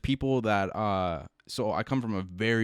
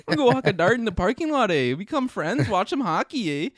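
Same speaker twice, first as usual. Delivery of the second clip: hammed-up Canadian accent, high and sing-song; 11 semitones higher, 5 dB louder than usual. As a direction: overdo the friendly Canadian with eh. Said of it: "Come to Canada".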